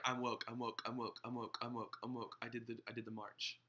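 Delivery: low, quiet, monotone string of boasts; mock woke boaster. Pastor imitating people who walk around bragging, mocking low murmur. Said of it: "When people walk around and be like".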